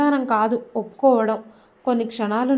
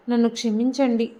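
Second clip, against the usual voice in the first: usual speaking voice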